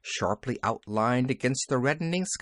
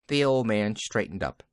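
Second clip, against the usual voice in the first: ordinary delivery, nothing marked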